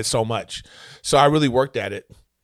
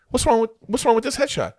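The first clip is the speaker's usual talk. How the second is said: puzzled executive; 10 semitones higher than usual. Retold or imitated. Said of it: "he goes".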